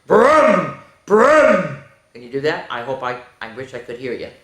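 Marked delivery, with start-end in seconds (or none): 0.1-1.8 truck engine sound. Shown as a demonstration. Teacher showing kids a truck noise. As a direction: rev it like a tough truck. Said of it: "I want you to go".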